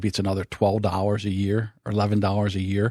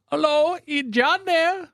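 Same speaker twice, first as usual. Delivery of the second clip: high, slow put-on caller voice, much higher than his own; imitating the telemarketer; slower than usual. Retelling a telemarketer's call to his friend, high mocking caller voice. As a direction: mimic the overseas sales caller, drawn out. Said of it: "And they go".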